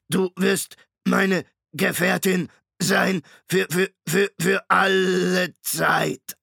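laut, langsam, höher, leiernd wie ein kaputtes Tonband; leiernde Maschinenstimme. A narrator's reading. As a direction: zieh den Namen wie ein defektes Band. Shrikes stotternde Maschinenstimme. (Shrike spricht Hester an, leiernd und knackend wie ein Tonband)

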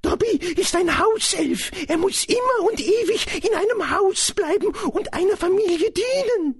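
very high-pitched elf voice; high, earnest creature voice; Dobby, dutiful house-elf. Acted. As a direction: explain it as a servile little elf.

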